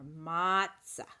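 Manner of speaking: ordinary delivery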